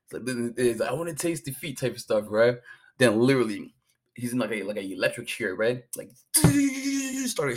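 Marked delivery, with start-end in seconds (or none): 0.9-1.8 higher, softer put-on Scottish accent; Doyle's line in an imitated Scottish accent; mock Scottish accent. Doyle's defiant last words in a mock Scottish accent. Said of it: "these guys have their little accent".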